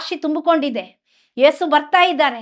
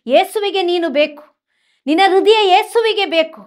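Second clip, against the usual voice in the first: fervent preaching; 3 semitones higher, 4 dB louder than usual. Acted. Preacher assures listeners Jesus wants their heart, in fervent preaching. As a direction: proclaim it like a revival preacher.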